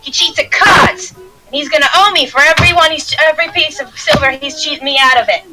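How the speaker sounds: high-pitched dwarven accuser; indignant dwarf woman. Acted. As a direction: announce the accusation to the whole tavern.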